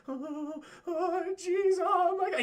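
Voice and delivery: high, wailing cartoon freak-out; mock panic. Mocking Antoine freaking out as he's scanned, high panicky wail. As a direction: whimper upward like a hammed-up coward. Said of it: "He's fucking freaking out".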